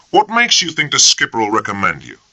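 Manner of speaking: sneering. A narrator's reading. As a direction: sneer the question. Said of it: "sneered Bennett".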